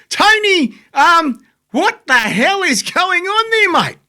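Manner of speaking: high-pitched, drawn-out, incredulous outburst; exasperated appeal. Acted. Host demanding answers from Tony over the ticket ban, exasperated. Host's mock-dramatic appeal to guest.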